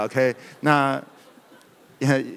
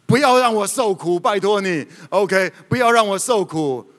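high, loud pleading cry; possessed man yelling high and loud; demon's shout; 6 semitones higher, 4 dB louder than usual. Acted. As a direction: cry out to Jesus, begging not to suffer. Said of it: "他大声喊着说".

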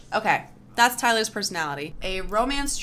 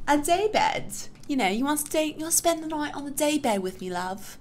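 put-on flirty British accent; 6 semitones higher than usual. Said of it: "my bad British accent".

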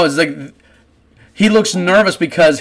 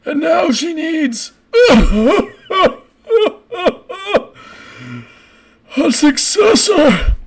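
put-on high, slow, drawn-out old-man proclamation; hammed-up old man's announcement breaking into laughter; 9 semitones higher, slower than usual. Old man proclaims the young successor to the crowd, old man voice. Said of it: "you hear this old man going".

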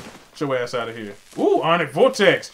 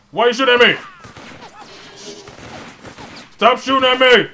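loud, high-pitched protest; indignant yell; 7 semitones higher, 4 dB louder than usual. Real, not acted. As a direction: yell back at whoever is firing at you. Gamer under fire yells at enemies.

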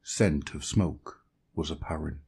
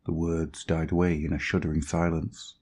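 ordinary delivery

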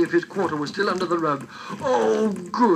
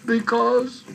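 sobbing; 6 semitones higher, 3 dB louder than usual. Acted. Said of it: "sobbed the little or-, orphan boy".

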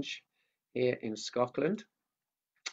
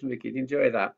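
ordinary talk

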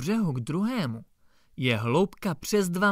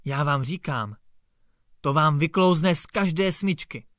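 usual speaking voice

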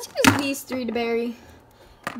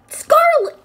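very high, loud outcry; shocked shout; 11 dB louder than usual. Real, not acted. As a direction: shriek her name in horror.

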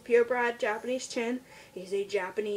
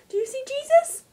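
high pet talk; 14 semitones higher, 6 dB louder than usual. Real, not acted. Talking to dog, high voice.

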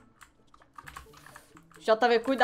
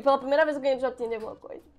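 normal talking voice

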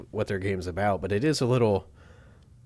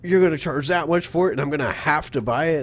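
mocking whine; 6 semitones higher, 6 dB louder than usual. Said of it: "people do always act like".

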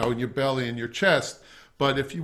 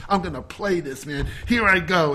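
tense psyched-up player; 3 semitones higher than usual. Retold or imitated. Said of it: "flexing your stomach and sitting up".

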